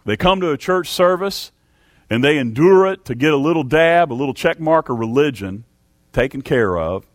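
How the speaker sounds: ordinary delivery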